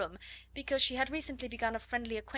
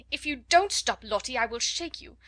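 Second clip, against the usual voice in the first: angry threat; 3 semitones higher, 7 dB louder than usual. A narrator's reading. Flustered teacher to a screaming child, angry threat. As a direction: turn sharply from coaxing to threatening. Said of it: "in quite another tone".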